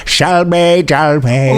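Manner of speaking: mock-mystical spell casting; Catweazle. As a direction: chant it like a batty old wizard. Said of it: "your Cat Weasel episode".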